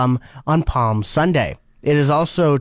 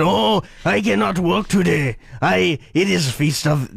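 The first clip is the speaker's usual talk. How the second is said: put-on French accent. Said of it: "that's really good French".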